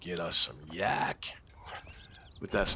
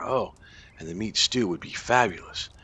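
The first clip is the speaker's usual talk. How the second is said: normal talking voice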